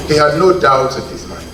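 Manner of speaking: usual speaking voice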